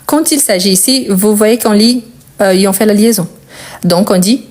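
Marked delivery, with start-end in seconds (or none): none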